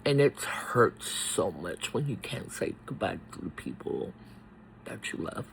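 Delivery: tearful trailing off; barely holding back tears; grieving. Real, not acted. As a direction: voice sinking soft and low, fading to a whisper. Grief over father's goodbye.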